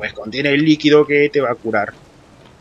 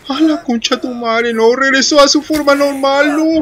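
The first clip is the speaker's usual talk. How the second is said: loud, higher cry of dismay; dismayed yell; 8 semitones higher, 4 dB louder than usual. Real, not acted. Gamer seeing the enemy come back, loud dismayed yell. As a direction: shout in disbelief. Monster revives in game.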